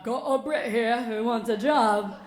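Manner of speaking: loud, slow manager's call; hammed-up loud announcement. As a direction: announce it across the room, drawn out. Manager announcing the British applicant upstairs.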